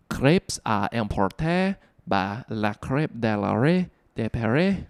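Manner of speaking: slow, hammed-up French accent; mock-perfect French accent. Shown as a demonstration. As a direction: read out the French name in a confidently bad French accent. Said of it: "that was perfect French".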